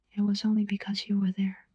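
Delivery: ordinary delivery, nothing marked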